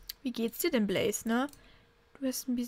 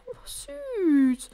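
much higher gushing coo; 8 semitones higher, 3 dB louder than usual. Real, not acted. About the kitten, high cooing. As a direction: melt over the cute kitten.

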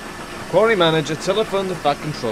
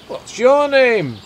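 higher, louder, slow question; inquiring driver; 8 semitones higher, 5 dB louder, much slower than usual. A narrator's reading. Driver asking the old coach, inquiring and raised. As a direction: call the question out slowly.

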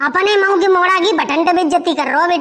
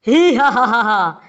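slow, low evil laugh; hammed-up villain cackle, dying away; Ravan; 6 semitones lower, slower than usual. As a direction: laugh like a stage demon king. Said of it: "Ravan हूँ मैं रावण".

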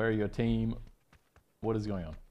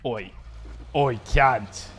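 broad mock Australian Oi; a lightly put-on Aussie bloke; Australian accent; much slower than usual. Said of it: "that fucking Australian".

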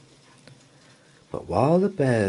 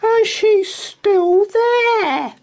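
very high, loud, slow squeak; tiny Baby Bear voice; 8 dB louder, slower than usual. Acted. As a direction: squeak out the discovery, wide-eyed. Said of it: "Baby Bear's tiny voice".

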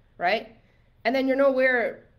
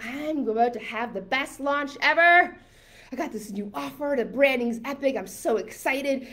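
giddy, higher-pitched boast; happy excitement; 4 semitones higher than usual. Acted. Coach voices a client thrilled about a launch, excited. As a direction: act out bursting pre-launch excitement. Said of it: "you were so happy".

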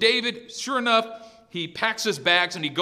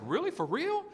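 high, soft, incredulous question; eager David; 7 semitones higher, 6 dB quieter than usual. Acted. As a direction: lift it high at the end, amazed. David voiced, eager about the reward.

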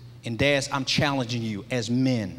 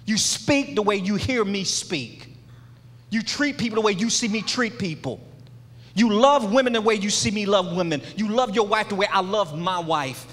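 raised-pitch, rhythmic litany, each line falling at the end; impassioned preaching; 5 semitones higher than usual. Real, not acted. Father charging his son to follow his example, impassioned preaching. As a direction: preach it in driving parallel phrases.

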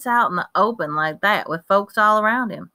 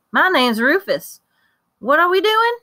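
put-on country boy; 10 semitones higher, 4 dB louder than usual. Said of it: "his country style of talking".

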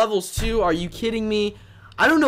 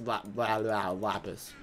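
stumbling stutter; 8 semitones lower, 9 dB quieter, slower than usual. Real, not acted. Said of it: "Yeah, great words, unspeakable".

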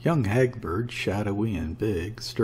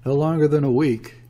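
joking retort, higher and louder; 6 semitones higher, 8 dB louder than usual. A narrator's reading. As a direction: toss it out as a lively joke. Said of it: "he joked".

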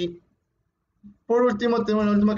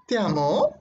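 "te amo" climbing steeply at the end, unsure; doubting question. Shown as a demonstration. Teacher showing how intonation changes meaning, doubtful. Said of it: "así como dudas que te amaban".

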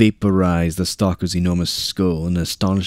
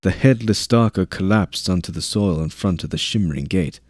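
usual speaking voice

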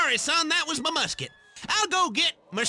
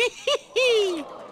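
hillbilly chuckle; 7 semitones higher than usual. Acted. Mocking laugh.

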